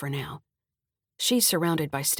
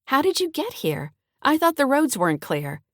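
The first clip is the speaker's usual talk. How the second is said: high, louder mother voice; mother's voice; 9 semitones higher, 5 dB louder than usual. A narrator's reading. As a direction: lift into mom's surprised voice.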